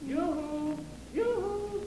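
high, sing-song call from a distance; calling yoo-hoo. Acted. Clarence chasing the girls down the street, sing-song call. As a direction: call out to catch their attention.

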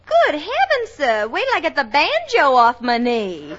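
put-on Southern accent. Secretary answers doubts about her legs, Southern accent. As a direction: play the indignant Southern belle. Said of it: "I come from Alabama".

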